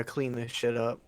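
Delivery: whispered under the breath; fed-up whispered aside. Retold or imitated. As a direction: grumble it in a whisper. Joins the whisper joke.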